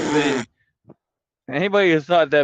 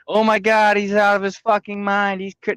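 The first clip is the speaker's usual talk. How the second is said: mocking gullible viewers; 8 semitones higher than usual. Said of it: "you stupid motherfuckers buy it".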